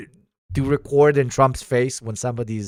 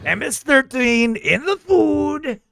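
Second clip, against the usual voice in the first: higher, emphatic mock-politician voice; boastful politician character; 9 semitones higher than usual. Remarking on the team's strong handshakes, boastful politician voice. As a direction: play a blustering leader with swooping stresses. Comic political figure riffing on food.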